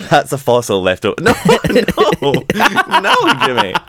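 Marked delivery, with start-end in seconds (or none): 1.2-3.7 high, loud laughing "no"; laughing protest. Real, not acted. Speaker correcting own silly slip, laughing hard. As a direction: squeal it helplessly through real laughter.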